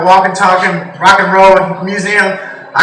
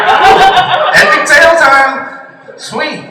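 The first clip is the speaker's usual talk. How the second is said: put-on much higher, breathy eager kid voice; mock eager kid; 8 semitones higher, much slower than usual. Grandson thrilled to hear grandpa's story, high mock eagerness. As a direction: perk up like an excited boy. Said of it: "I'm like".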